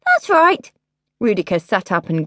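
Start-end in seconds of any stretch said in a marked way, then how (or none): 0.1-0.7 sulky princess voice. Acted. Rudica eager.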